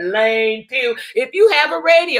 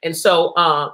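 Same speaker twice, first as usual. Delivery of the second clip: ordinary talk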